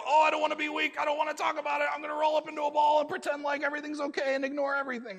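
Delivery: loud, fast, high whining protest; hammed-up kid shouting that he doesn't want to be weak; whiny avoidant kid. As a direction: wail it like a kid refusing to face it. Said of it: "We become like Percy. We go".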